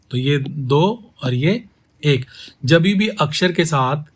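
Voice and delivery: ordinary talk, nothing marked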